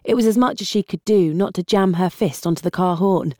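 ordinary delivery

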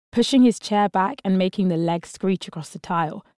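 usual speaking voice

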